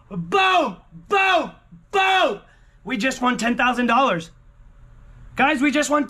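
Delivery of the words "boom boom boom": excited shouts. Real, not acted. Celebrating the big win.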